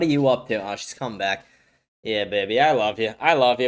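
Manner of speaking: love on the dog gently; tender to dog. Real, not acted. Streamer cooing at his dog, low and tender.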